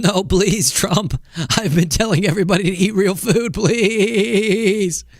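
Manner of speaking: high, wavering, strained plea; mock pleading. Health official begging Trump about real food, hammed-up quavering plea. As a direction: beg in a quavery put-on voice, stretching the last please.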